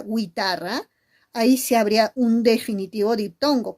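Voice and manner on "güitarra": "guitarra" as "güitarra", gwi at the start; hypothetical gwi-ta-rra for "guitarra"; sounded U. Shown as a demonstration. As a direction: say "guitarra" with the U pronounced like W. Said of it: "si yo diría".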